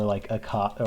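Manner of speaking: usual speaking voice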